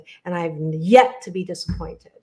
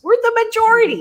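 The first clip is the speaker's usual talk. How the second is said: triumphant, much higher and louder declaration; excited exclamation; 14 semitones higher, 7 dB louder, faster than usual. Real, not acted. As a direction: leap way up and shout it out. Rallying point about trauma majority.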